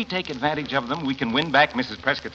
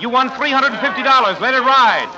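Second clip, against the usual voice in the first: higher, louder, eager urging; excited; 10 semitones higher, 7 dB louder than usual. Acted. Father urging his son to let it ride, excited. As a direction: push him to bet it all.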